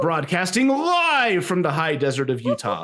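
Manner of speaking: high-pitched ringside introduction; hammed-up fight-night emcee; wrestling announcer. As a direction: announce it live to a roaring arena. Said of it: "my best wrestling announcer voice".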